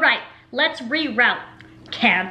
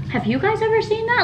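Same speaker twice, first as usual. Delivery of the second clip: quoting the vet's surprise, pitched up; surprised vet; 11 semitones higher than usual. Retold or imitated. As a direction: vet staff amazed she never saw foaming, surprised.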